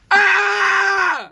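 high shriek. Real, not acted. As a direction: shriek loudly. Shriek again.

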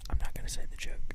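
fast whisper. Pretend. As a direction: hurry it out in a whisper. Whispered refusal.

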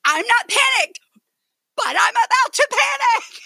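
high, loud, laughing mock panic; exaggerated panic. Sister mocking her panicked boat plea, loud and laughing. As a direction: ham up a near-panic squeal. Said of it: "Here's how she said it".

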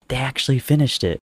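high, thrilled exclamation; excited. A narrator's reading. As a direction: burst out with delight. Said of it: "couldn't help the laugh".

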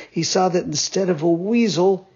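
usual speaking voice

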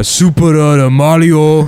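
slow Super Mario in a mock Japanese accent; Super Mario in a hammy Japanese accent. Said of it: "No, it's like".

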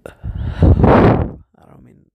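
real tears catching in the throat, slow and breathy-broken; words breaking as real crying starts; choking up. Real, not acted. Telling viewers about the girl, voice breaking into real tears. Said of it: "I'm sorry if I cry".

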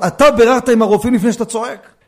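loud retort. Retold or imitated. Rabbi answering a shouting father on the phone, loud retort. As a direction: fire the question back loudly and quickly.

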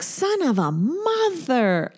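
slow drawn-out curse at a dented bumper; frustrated curse. Retold or imitated. Slow exasperated curse at her dented car. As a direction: stretch it out, fed up.

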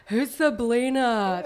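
slow mocking troll voice. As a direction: drag it out like a sneering troll. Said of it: "they have different voices in my head".

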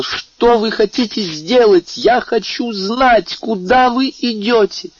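very high, slow, pleading cry; desperate cry. A narrator's reading. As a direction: cry out after him in panic, high and drawn out. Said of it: "воскликнула". (отчаянный, высокий, протяжный крик)

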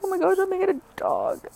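high, fast, thrilled squeal; excited squeal. Retold or imitated. Waiting in the car, squealing excitedly about the dog. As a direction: gush about getting a dog, quick and high. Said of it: "I'm like".

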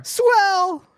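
gleeful exclamation; exclaimed. Acted. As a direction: burst out in delight. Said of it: "exclaimed in unison".

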